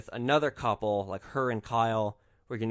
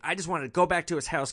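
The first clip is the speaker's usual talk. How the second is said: usual speaking voice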